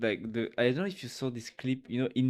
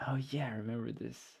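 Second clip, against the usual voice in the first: fast, softer whisper; 3 semitones lower, 9 dB quieter, faster than usual. Real, not acted. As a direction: whisper it quickly to yourself. Suddenly remembering a stream.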